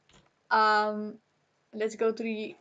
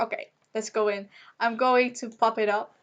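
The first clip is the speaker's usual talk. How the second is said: ordinary talk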